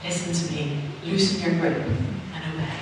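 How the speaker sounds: soft, tender coaxing; gentle and nice. Retold or imitated. God gently coaxing her to let go, soft and tender. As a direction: speak kindly, like coaxing a child. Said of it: "He tried to do it nicely".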